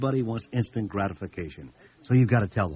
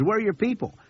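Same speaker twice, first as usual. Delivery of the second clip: much higher mock voice for the soda salesman; imitating the pop guy; 13 semitones higher than usual. Soda pop salesman asking about the missing contractors, mock high voice. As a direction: put on a high, needling salesman voice.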